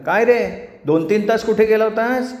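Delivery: high-pitched questioning wife; suspicious wife. Acted. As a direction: demand to know where he has been. Wife questions husband in story. (संशयी बायकोचा चढा आवाज)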